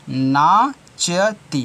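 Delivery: नाचती drawn out very slowly as naa-cha-tee; syllable by syllable. Shown as a demonstration. Teacher corrects the word for children, नाचती slowly syllable by syllable. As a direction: sound out नाचती in separated, stretched syllables.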